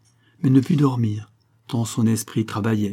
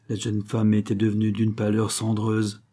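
ordinary delivery, nothing marked

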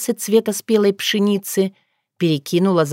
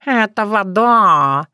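slow, drawn-out cat character; talking cat; 3 semitones higher, much slower than usual. Acted. Cat announcing what is in the glass, slow and drawn-out. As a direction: stretch the vowels like a lazy cat.

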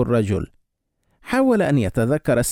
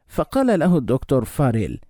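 ordinary delivery, nothing marked

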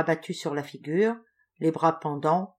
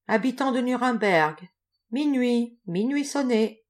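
higher, sustained calling out; watchman's cry; 6 semitones higher, 3 dB louder than usual. A narrator's reading. Watchman to the people of Nuremberg, calling out. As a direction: call the hour out like a night watchman. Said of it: "la voix du watchman qui criait".

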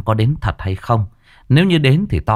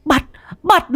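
shrill yell, far higher; bawling. Acted. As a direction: bawl it out from the ground. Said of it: "vẫn còn ngoạc mồm". (gào toáng lên, giọng rất cao)

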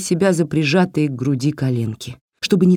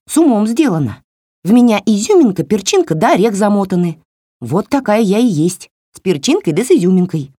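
higher, snappy little doll telling her off; scolding doll voice; 4 semitones higher than usual. Acted. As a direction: scold like a cheeky tiny doll. Doll talks throughout. (высокий бранчливый голосок куклы)

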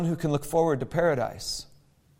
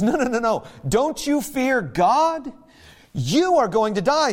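urgent rebuke; 5 semitones higher than usual. Acted. Criminal rebuking his fellow on the cross, urgent raised voice. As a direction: shake him awake to the truth.

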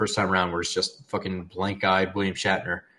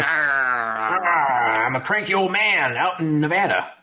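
put-on cranky old man voice; 3 semitones higher than usual. Plays cranky old man in Nevada.

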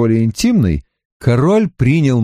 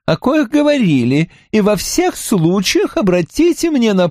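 countess voice; 10 semitones higher than usual. Acted. Mahaut explains her refusal.

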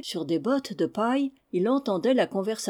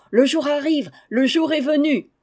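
higher, louder joyful shout; excited shouting; 7 semitones higher, 6 dB louder than usual. Acted. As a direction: announce the dawn with glee. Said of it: "en criant". (les valets crient de joie que le jour est venu)

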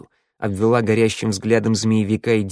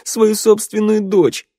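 high, loud, anguished cry; choked-back sobs; 12 semitones higher, 6 dB louder than usual. A narrator's reading. Daughter forgotten by her father, crying out in anguish. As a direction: wail it out, fighting back sobs. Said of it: "Девушка подавила всхлип".

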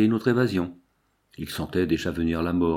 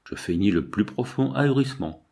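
plain reading voice

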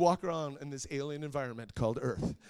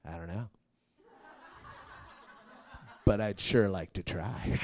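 Mark's cool reply; 8 semitones lower than usual. Retold or imitated. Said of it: "And Mark goes".

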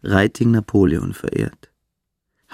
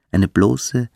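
ordinary talk, nothing marked

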